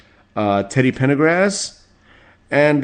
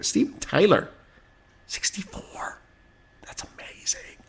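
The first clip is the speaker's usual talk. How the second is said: awed whisper; 9 semitones higher, 8 dB quieter than usual. Real, not acted. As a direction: drop to a breathy hush on the age. Stunned by a rock idol's age.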